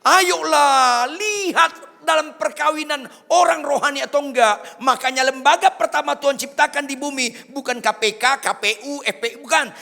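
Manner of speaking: loud, high sermon shout; full-force preaching. Real, not acted. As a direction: thunder it at the congregation.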